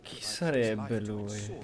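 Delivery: ordinary talk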